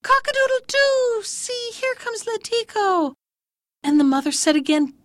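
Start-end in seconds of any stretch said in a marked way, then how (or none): 0.0-3.1 very high, singsong rooster call; crowing cock. A narrator's reading. Cock heralding Letiko's return, crowing. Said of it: "cock spied and called out".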